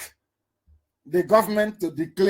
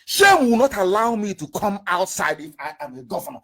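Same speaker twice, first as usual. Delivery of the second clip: ordinary talk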